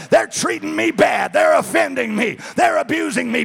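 high, loud complaining voice; whiny inner voice. Pretend. Pastor imitating an offended inner voice, high loud complaint. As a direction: whine about being wronged. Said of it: "a voice that says".

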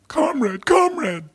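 high, loud cry of alarm; panicked shout. Retold or imitated. Aides crying out over the fallen Stalin, high panicked shout. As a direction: shout it up high, calling him to wake.

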